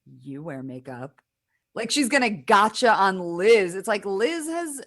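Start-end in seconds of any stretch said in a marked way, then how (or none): 0.1-1.2 low, slow, quiet smug accusation; smug and serious. Retold or imitated. Caitlin getting smug with Liz about makeup, smug and serious. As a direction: imitate someone gravely smug, softly and slowly. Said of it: "gets really smug and serious".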